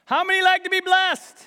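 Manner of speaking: loud, high call to the congregation; rally the room with the question; preacher's call out. Real, not acted. Preacher rousing the congregation, loud call. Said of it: "say it like you mean it".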